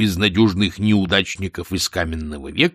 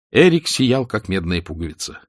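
ordinary delivery

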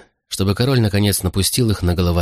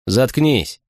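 mildly commanding, pitched up; curt order; 4 semitones higher than usual. A narrator's reading. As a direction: order a subordinate to be silent. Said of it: "приказал сержант". (сдержанный приказ, чуть повышенным тоном)

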